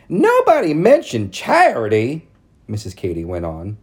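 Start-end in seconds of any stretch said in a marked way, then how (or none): 0.1-2.3 high, loud prissy society matron; prim town lady. A narrator's reading. Narrator voicing Missus Cady calling on Mama, high and prim. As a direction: lift it up into a fussy church lady. Ladies voiced in read story.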